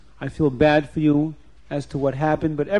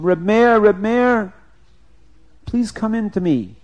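raised, higher calling voice; 5 semitones higher, 5 dB louder than usual. Acted. Rabbi summoning Reb Meir from the little room, calling out. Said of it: "a voice came from the little room".

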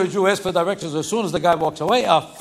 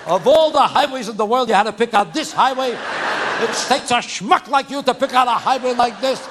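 nagging wife; 5 semitones higher than usual. Acted. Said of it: "every wife in the world says the same thing".

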